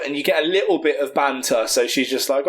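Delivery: normal talking voice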